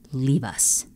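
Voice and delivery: low, soft, menacing command; low commanding emperor; stern order. Acted. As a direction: dismiss them coldly. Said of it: "Bellows ordered".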